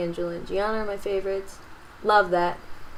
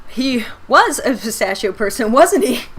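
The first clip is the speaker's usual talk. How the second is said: teasing; 4 semitones higher, 8 dB louder than usual. Real, not acted. Teasing answer about pistachio.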